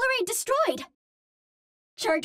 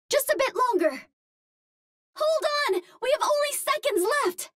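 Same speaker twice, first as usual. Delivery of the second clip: higher, pressing call to hold on; rally the team before time runs out; urgent rally; 5 semitones higher than usual. Acted. Hero urges teammates as time runs out, urgent.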